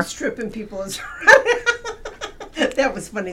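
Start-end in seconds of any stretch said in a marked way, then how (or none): 1.0-2.7 high laughing outburst; real burst of laughter. Real, not acted. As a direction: crack up at the memory. Funny dinosaur tripping people.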